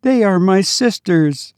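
put-on high, sweet little child calling his mother; a small dead child greeting his mother with joy; child's voice. A narrator's reading. Dead child to his weeping mother, high sweet child voice. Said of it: "said the child".

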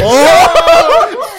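high, loud shocked laugh. Real, not acted. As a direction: burst out with delight.

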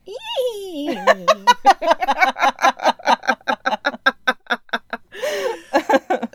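long high laughing fit; wheezing laughter. Real, not acted. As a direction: laugh till you wheeze.

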